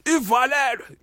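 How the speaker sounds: high, loud impression of an old-school uncle; rural uncle. Retelling an uncle's answer to the condom question, loud imitated uncle. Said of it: "this is an uncle of mine from".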